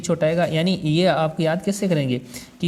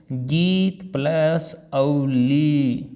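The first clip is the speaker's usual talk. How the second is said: गीत plus अवली spelled out very slowly; very slow breakdown; much slower than usual. Shown as a demonstration. Teacher slowly splits the compound for students. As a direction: drag out each part slowly. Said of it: "संधि करके अपन याद कर सकते हैं".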